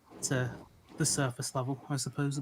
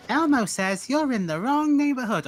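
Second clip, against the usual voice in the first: high, loud, slow puppet threat; squeaky puppet making a threat; menacing Elmo voice; 12 semitones higher, 7 dB louder than usual. Pretend.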